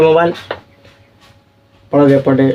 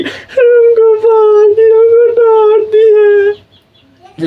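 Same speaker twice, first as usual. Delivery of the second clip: high, slow wailing sob; crying wail; 4 dB louder, much slower than usual. Retold or imitated. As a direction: wail like a little girl just scolded by papa. Girl cries after father scolds. (ऊँची आवाज़ में बिलखते हुए रोना)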